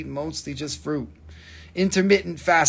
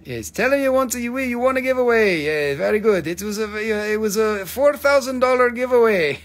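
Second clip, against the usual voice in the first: playful sales pitch in a higher put-on voice; put-on seller voice; 7 semitones higher, 5 dB louder than usual. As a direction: ham up a pushy gem saleswoman.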